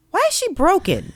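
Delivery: put-on high, innocent child's question; little boy voice. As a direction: play a puzzled toddler asking earnestly. Toddler son voiced again.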